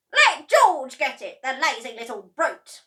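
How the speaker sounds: high, very loud scornful yell; Grandma shouting. A narrator's reading. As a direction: bark at the lazy boy. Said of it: "Grandma shouted".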